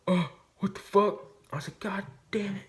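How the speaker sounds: high, exasperated cursing; can't believe the gun failed; frustrated outburst. Retold or imitated. Hunter cursing the jammed shotgun, high frustrated outburst. Shotgun only clicks, won't fire.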